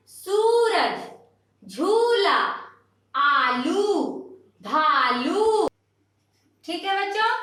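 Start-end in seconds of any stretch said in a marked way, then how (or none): 0.1-5.7 सूरज, झूला, आलू, भालू with each u held long; drawn-out long vowel. Shown as a demonstration. Teacher listing long-u words for children, dragging each u. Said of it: "अब दीर्घऊ की मात्रा पे चलते हैं".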